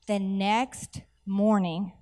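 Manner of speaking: very slow, drawn-out words; dramatic slow reveal. Real, not acted. Preacher building up to her miracle story, dramatically slow. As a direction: stretch each word for suspense.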